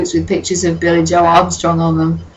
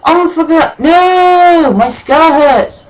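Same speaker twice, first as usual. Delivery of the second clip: much higher, loud pained cry; hammed-up scream; 11 semitones higher, 5 dB louder, slower than usual. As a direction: wail like a drama queen. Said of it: "he started to scream".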